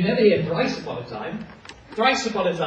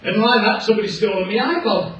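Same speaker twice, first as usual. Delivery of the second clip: loud, gruff-free blustering dad; Daddy Bear; 3 semitones higher, 8 dB louder than usual. Acted. Daddy Bear bursting in about his iPod, loud. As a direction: boom it out like an outraged father bear. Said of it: "Daddy Bear comes in and says".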